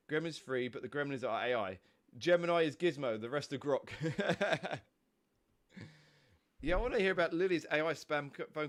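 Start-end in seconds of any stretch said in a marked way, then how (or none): 3.9-4.8 short burst of laughter; amused laugh. Real, not acted. Reading chat's joke about Gremlins being AI, amused laughing. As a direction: chuckle aloud at the joke.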